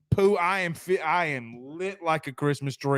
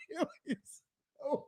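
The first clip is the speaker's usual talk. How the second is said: laughing; 9 semitones higher, 14 dB quieter, much slower than usual. Real, not acted. Reading chat while laughing.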